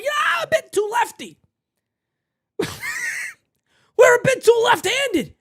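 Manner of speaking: high, slow, laughing mockery of the general manager. Vlogger mocking the general manager's lefty excuse, hammed up. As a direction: ham up the clueless executive's excuse.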